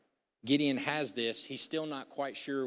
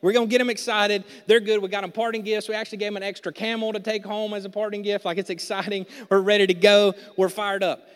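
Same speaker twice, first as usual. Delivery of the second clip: loud, higher, pumped-up bragging; hyped-up Gideon; 6 semitones higher, 10 dB louder than usual. Retold or imitated. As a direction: play Gideon all fired up for battle. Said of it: "he's like".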